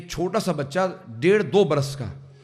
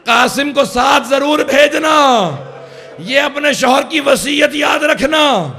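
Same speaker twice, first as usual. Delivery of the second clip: higher, louder keening plea, slow; wailing last will; 9 semitones higher, 11 dB louder, slower than usual. Retold or imitated. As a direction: cry it out as a dying husband's bequest. Dying husband's last will to wife. (بیوی کو آخری وصیت، بلند، روتی ہوئی آواز میں)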